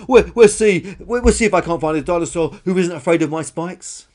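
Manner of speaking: high, loud stammered vow; defiant shouting. Acted. Dinosaur vows to find a fearless friend, defiant shouting. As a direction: shout it as a challenge. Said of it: "shouted Karen".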